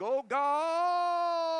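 very high, loud drawn-out wail; hammed-up wail, stretch God right out; mock agonised prayer. Preacher imitates people praying, mock agonised wail. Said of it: "they get their voice going".

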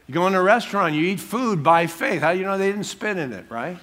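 usual speaking voice

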